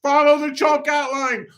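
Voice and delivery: high, strained mock yell; coach calling out. Coach directing the lost kid runner, yelling. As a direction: call the directions high across a field.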